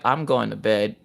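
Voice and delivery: weary sign-off. Retold or imitated. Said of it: "Becky's just like".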